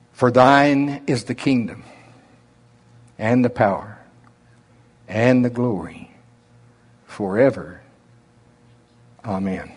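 slow, drawn-out reading with long pauses; unhurried prayer read in his own drawl; slow Oklahoma drawl. Shown as a demonstration. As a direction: let every phrase of the prayer stretch out slowly. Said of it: "I'm gonna add the doxology".

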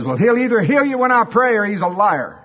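loud, high, blunt comeback; shouted retort. Acted. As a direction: fire it back across the street. Preacher's shouted retort across street.

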